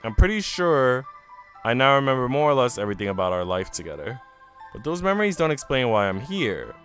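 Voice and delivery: normal talking voice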